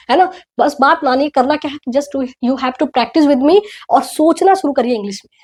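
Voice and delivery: ordinary delivery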